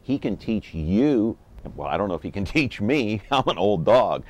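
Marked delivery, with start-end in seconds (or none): none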